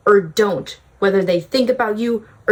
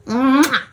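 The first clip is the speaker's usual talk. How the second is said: blown kiss; 5 semitones higher, 3 dB louder than usual. Pretend. Blowing a kiss goodbye.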